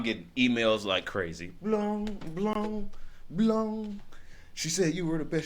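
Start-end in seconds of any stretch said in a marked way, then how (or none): 1.6-4.0 high, soft, ringing notification noise; imitated email chime. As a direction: mimic repeated email alerts in a high ring.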